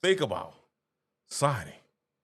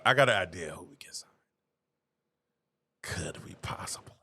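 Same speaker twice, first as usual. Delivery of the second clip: whisper; 12 semitones lower, 6 dB quieter than usual. Pretend. Conspiratorial joke to viewers.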